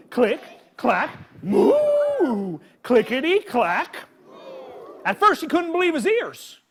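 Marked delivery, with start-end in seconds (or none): 1.4-2.6 high, drawn-out cow moo. Acted. Reading the cow part to kids as a long moo. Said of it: "all day long he hears".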